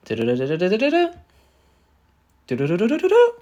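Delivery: rapid rising du-du-du stutter; fast vocal du-du run climbing high at the end; imitated signature sound. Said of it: "she hears the signature".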